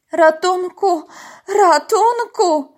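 high, loud, drawn-out call; cry for help. A narrator's reading. Frightened dolphin calls at the boat for help. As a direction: shout for rescue, stretching it the second time. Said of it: "zawołał delfin".

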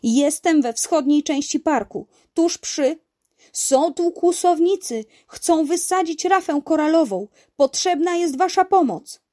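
high, loud report to police; pressing plea for help; urgent emergency call. Acted. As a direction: raise the alarm over the phone. Uncle urgently phones police for help.

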